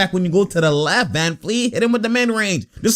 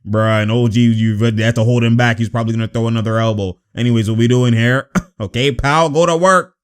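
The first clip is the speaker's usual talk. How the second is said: usual speaking voice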